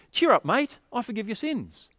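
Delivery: put-on hearty Aussie Jesus with an Australian accent. Jesus cheering the paraplegic in an Australian accent. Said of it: "That's the Australian version".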